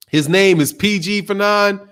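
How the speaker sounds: ordinary talk, nothing marked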